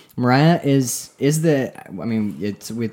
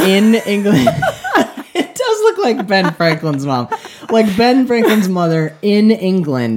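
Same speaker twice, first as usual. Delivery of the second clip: talking through real laughter, higher and louder; laughing; 11 semitones higher, 8 dB louder than usual. Real, not acted. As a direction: giggle the words out. Laughing at old lady picture.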